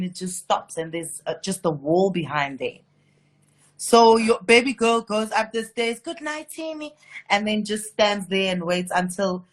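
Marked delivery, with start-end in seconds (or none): 6.0-6.9 soft, higher-pitched goodnight line; lightly played high, quiet bedtime line; lightly acted goodnight. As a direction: call a sweet little goodnight in character, softly. Acts her character's bedtime line.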